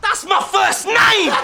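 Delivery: excited punchline. Acted. Punchline reveal in rap battle.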